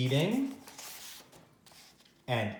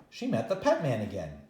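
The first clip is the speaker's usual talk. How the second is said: ordinary delivery, nothing marked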